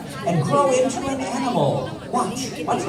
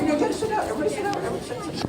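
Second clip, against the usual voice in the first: high-pitched pleading; 8 semitones higher, 6 dB quieter, much faster than usual. Real, not acted. Telling kids to sit.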